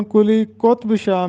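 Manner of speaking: قطب in کلی قطب Shah said qotb, ط without a vowel; درست تلفظ. Shown as a demonstration. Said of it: "اور توئے پر جزم ہوگا". (کلی قطب شاہ میں قُطْب، ط ساکن)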